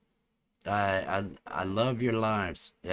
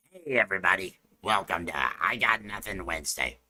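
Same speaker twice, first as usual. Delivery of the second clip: gurgly alien announcer voice; gurgling alien voice; 4 dB louder, faster than usual. Acted. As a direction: greet them like a bubbling alien. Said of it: "How's that? That should work. That'll be the voice".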